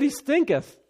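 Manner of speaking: high squeak of he stinketh; a comic high-pitched Martha voice; high mock Martha. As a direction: squeal it like a fussy woman. Comic repeat of Martha's line.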